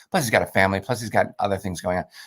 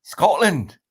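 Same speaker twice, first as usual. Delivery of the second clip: brief high-pitched attempt at a Scottish accent; limited go at Scottish, pitched up; 7 semitones higher than usual. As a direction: echo it in a put-on Scottish accent. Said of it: "That's all I can do".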